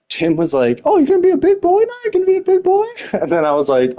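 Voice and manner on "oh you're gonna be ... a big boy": high, fast, louder mocking voice; imitated taunt. Tim taunting him in an argument, imitated high and mocking. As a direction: jeer it in a squeaky mock voice.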